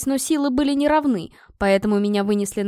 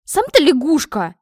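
loud, higher-pitched comeback; offended, raised-voice protest; indignant retort; 4 semitones higher, 6 dB louder than usual. Acted. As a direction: hurl the insult back hotly. Princess hurls insult back.

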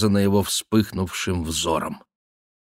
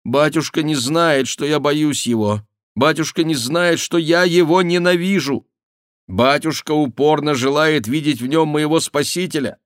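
high, agitated protest; impassioned exclamation; 7 semitones higher, 4 dB louder than usual. A narrator's reading. Daughter protests that father doesn't understand, impassioned and high. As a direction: plead with rising pitch and force. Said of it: "воскликнула Диана".

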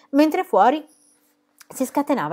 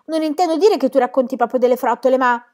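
spaventata; 5 semitones higher, 5 dB louder than usual. Acted. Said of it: "disse la bambina spaventata".

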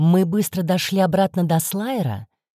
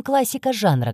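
ordinary delivery, nothing marked

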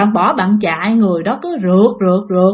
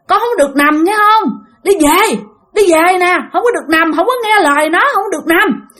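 urgent calling out; 9 semitones higher than usual. Retold or imitated. Spirit of old man calling the girl home, urgent shout. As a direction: call her back home before it's too late.

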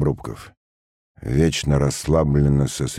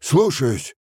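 raised-pitch military acknowledgement; obedient soldier; 9 semitones higher than usual. A narrator's reading. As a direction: answer the officer's order at once. Sergeant answers an order. (по-военному откликается)

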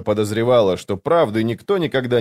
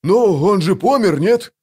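high, loud, indignant comeback; aggrieved, defensive outburst; offended retort; 9 semitones higher, 5 dB louder than usual. A narrator's reading. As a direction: snap back with hurt pride, voice jumping up. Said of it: "обиженно огрызнулся Вал".

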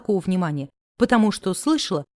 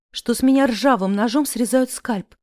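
ordinary delivery

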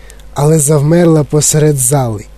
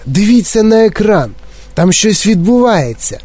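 higher, excited calling out; exclaiming; 6 semitones higher than usual. A narrator's reading. Wonka pointing everyone to the screen, excited. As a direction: draw everyone's attention, pitched up. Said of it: "вигукнув містер Вонка".

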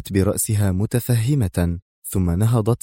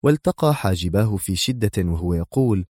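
ordinary delivery

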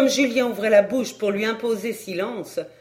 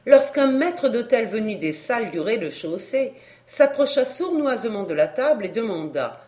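usual speaking voice

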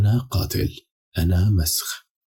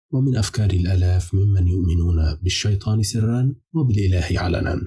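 ordinary delivery

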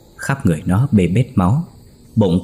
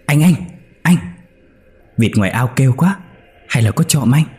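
anxious wake-up calls, pitch higher on the question; urgent calling; 5 semitones higher than usual. Acted. Wife urgently waking her husband over noisy ducks, alarmed calling. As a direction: shake him awake with your voice.

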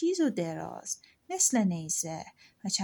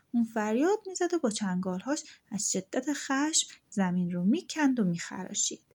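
ordinary delivery

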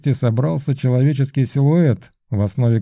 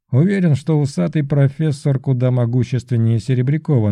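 plain reading voice

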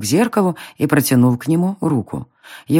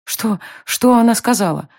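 hurried question; 7 semitones higher than usual. A narrator's reading. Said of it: "торопливо спросила я".

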